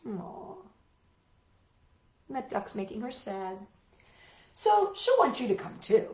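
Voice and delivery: ordinary talk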